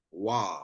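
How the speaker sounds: "wa" with a w, low and soft; pronounced wa. Shown as a demonstration. Teacher sums up the rule, soft low "wa". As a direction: say a low drawn-out "wa". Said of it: "When it needs to pronounce as".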